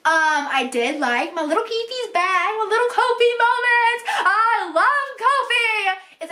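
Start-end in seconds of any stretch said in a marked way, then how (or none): 1.4-6.0 gushing adoration. Real, not acted. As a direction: gush over a favourite pairing, stretching the last words. Gushing over favourite character pairing.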